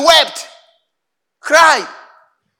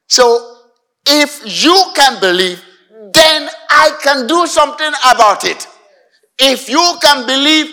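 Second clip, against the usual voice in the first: loud, fervent sermon delivery, slowing to cry out key lines; full-force preaching; 6 semitones higher, 7 dB louder than usual. Acted. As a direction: preach it with rising fire to the congregation.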